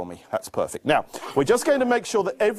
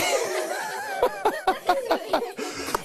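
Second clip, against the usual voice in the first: laughing; 4 dB quieter than usual. Real, not acted. Laughs at helper.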